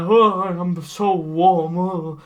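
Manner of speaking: slow, drawn-out hot moan; slow moaning Santa. Retold or imitated. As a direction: moan about the heat slowly. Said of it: "he's going like".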